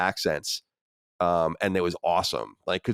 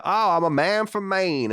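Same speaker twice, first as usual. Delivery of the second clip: put-on slow, higher Maine accent; 10 semitones higher, slower than usual. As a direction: drag out "Oh, I'm a man from Maine" like a Maine local. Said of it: "like, the Maine".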